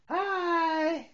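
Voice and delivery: loud, high, bright peekaboo hi; excited greeting. Real, not acted. Parent plays peekaboo with toddler, high excited hi.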